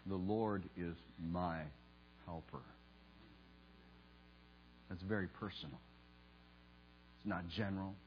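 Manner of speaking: soft, low, with long reverent pauses; hushed, intimate preaching. Real, not acted. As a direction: bring it down low and let each phrase settle. Hushed personal appeal to congregation.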